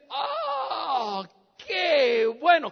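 drawn-out, gushing oh, high at first; delighted exclamation. Retold or imitated. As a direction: exclaim with big, stretched-out delight. Retelling Russian host's delighted exclamation.

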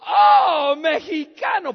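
loud, high burst of recognition; big drawn-out oh, then exclaim; delighted surprise. Retold or imitated. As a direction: mimic a thrilled host crying out. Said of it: "abrió los ojos, dice".

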